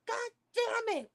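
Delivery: high, slow cry of frustration; exasperated. Real, not acted. Reacting to a baby killed, exasperated. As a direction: throw up your hands.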